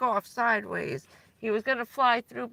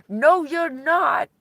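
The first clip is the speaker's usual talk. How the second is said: retold protest; 6 semitones higher, 8 dB louder, slower than usual. Stuck friend refuses ambulance.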